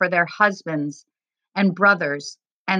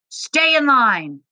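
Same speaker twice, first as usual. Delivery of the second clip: higher, slow, drawn-out order; yelling soldier; 6 semitones higher, 3 dB louder, slower than usual. A narrator's reading. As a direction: yell a slow command down the line. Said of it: "the soldier yelled".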